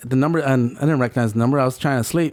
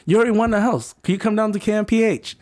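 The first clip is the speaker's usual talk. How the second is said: higher-pitched, insistent caller; upbeat retold phone pitch; imitated prize caller; 7 semitones higher than usual. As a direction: act out a caller talking someone round. Voices caller reassuring the winner.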